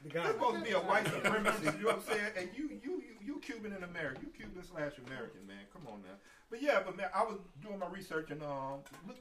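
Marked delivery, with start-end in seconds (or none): 0.0-6.2 laughing disbelief at the man; chuckling through a mock scolding. Mocking the Cuban Proud Boys leader.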